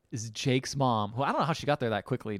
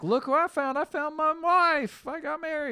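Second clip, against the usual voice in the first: giddy groom. Retold or imitated. Groom proudly announcing to the bar.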